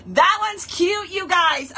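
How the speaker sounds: usual speaking voice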